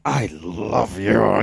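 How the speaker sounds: low, drawn-out fake declaration; put-on lovey voice, stretched out; mock insincere love. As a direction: exaggerate a slow, deep "I love you". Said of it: "I wasn't lying. I wasn't like".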